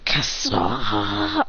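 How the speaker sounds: low, drawn-out Japanese curse; frustrated low oath; Adrian. Acted. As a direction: stretch the curse out low and strained. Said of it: "Adrian says".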